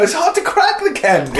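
high, giggling through the words; laughing. Real, not acted.

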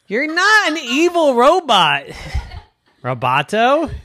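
high, loud scolding; indignant protest. Acted. Evan scolding robot after threat, indignant protest.

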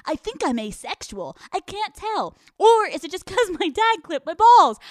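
loud, high-pitched talking dog; Henry the dog. Acted. Owner parodying dog captions as the dog, loud and excitable. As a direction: play a bolder, excitable dog, punched out loud. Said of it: "pretending to be their dog".

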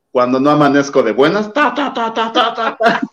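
high, dismissive diva voice; [name] impression. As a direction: rattle off the ta ta ta high. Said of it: "[name]". (imitando a Lupita con tono altivo)

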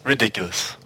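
usual speaking voice

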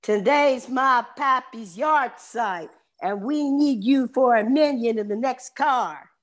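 high, drawn-out Texan voice; big Texan. Acted. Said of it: "the man looks at him and says".

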